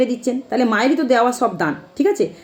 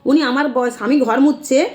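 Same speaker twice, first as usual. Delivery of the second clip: ordinary delivery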